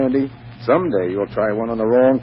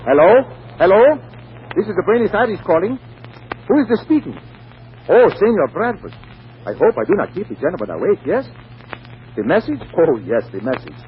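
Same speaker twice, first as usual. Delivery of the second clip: higher, put-on Latin American accent for a prank call; fake South American accent; 7 semitones higher than usual. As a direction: play the Buenos Aires agent with a Spanish lilt, teasing. Mark fakes South American agent call.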